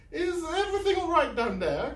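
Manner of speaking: high, loud call from upstairs. Acted. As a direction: call down the stairwell. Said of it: "called Madame Lavinia".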